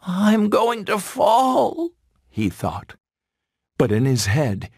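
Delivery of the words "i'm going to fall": high, drawn-out fawn wail; alarmed cry. A narrator's reading. Wounded Bambi to himself as he runs, alarmed high cry.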